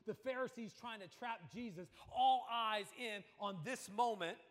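fiery preacher voice; forceful preaching. Acted.

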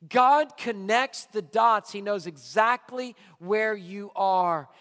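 God and dots pressed slow and loud; preacher proclaiming slowly to the congregation; slow, loud proclamation. Real, not acted. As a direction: proclaim it slowly, each word weighted. Preaching emphatic slow statement.

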